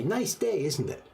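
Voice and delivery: bright high-pitched hello to a stranger; lightly acted cheerful greeting; friendly greeting. Shown as a demonstration. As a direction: chirp it like a cheery passerby. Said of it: "You meet somebody and you say".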